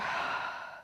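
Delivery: long, airy letting-go sigh; soft, voiceless rush of breath; demonstrated sigh. Shown as a demonstration. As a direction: sigh out and let it all go. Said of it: "Exhale, side out, let it go".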